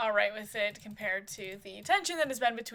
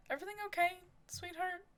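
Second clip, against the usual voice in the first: higher, softening voice as you check in; soft, high concerned question; gentle concern; 9 semitones higher, 5 dB quieter, faster than usual. Acted. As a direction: ask tenderly, lifting the pitch. Father checks on upset daughter.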